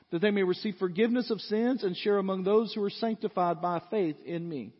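loud, high preaching of the text; booming pulpit reading; preaching scripture. Acted. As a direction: proclaim it to the whole church. Preacher reads scripture at full force.

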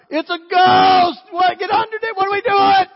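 piercing, high-pitched mock scream; panicked yelling. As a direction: shriek like a whole crew in terror. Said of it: "They're yelling".

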